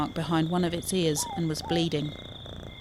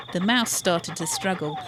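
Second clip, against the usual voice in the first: ordinary delivery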